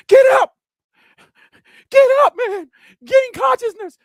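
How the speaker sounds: very high, loud pleading shouts; acted frantic shouts at a fallen opponent; urgent yelling. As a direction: yell at a knocked-down fighter to get up. Urging a knocked-out boxer.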